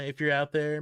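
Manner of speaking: normal talking voice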